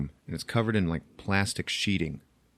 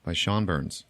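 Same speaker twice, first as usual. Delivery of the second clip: normal talking voice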